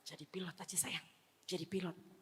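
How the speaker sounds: very soft, hushed coaxing; hushed, hypnotic nudge to a loved one; coaxing brainwash. Retold or imitated. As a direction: murmur it sweetly into a husband's ear. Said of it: "saya mulai brainwash suami saya".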